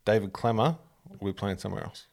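ordinary delivery

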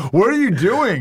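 high-pitched incredulous question; mock appalled. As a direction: act out a baffled onlooker. Appalled wedding guests, acted out.

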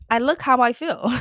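laughing. Real, not acted. Laughs at own messy look.

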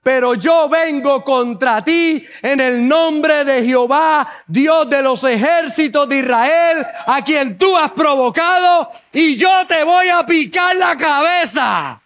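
slow defiant proclamation building to a shout; David defies Goliath, slow, rising to a shout; bold David. Acted. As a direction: declare it slow, then roar the last line. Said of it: "Lo miró y le dijo".

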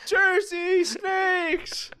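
high, dragged-out mock terror; hammed-up scream at snakes; cartoon horror at Jersey snakes; mock panic. Mock Indiana Jones panic.

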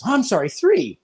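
high-pitched, insistent comic apology; mock over-apology. Indonesian not wanting to be outdone, eager mock apology. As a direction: push the pitch up to outdo him.

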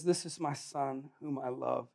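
quiet voice of God; solemn Father. Acted. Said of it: "the Father speaks from heaven and says".

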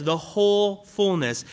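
"whole" stressed and held. Shown as a demonstration. Pastor pointing out the key word, stressing whole. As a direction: mark "whole" in "The whole fullness". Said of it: "it says".

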